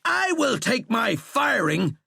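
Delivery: high, slow, loud roar; furious roar. A narrator's reading. General answering his sacking, roaring. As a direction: roar it back defiantly. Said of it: "Sheckley roared".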